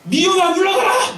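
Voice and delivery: high, loud, slow rebuke; shouting prayer. Retold or imitated. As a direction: cast out hatred at full voice. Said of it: "막 하면서". (높고 크게 천천히 꾸짖듯 외치기)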